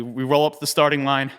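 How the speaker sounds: normal talking voice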